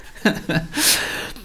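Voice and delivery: short chuckle without words; laugh. Real, not acted. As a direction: laugh at your own impression. Laughs after child impression. (kurzes Auflachen)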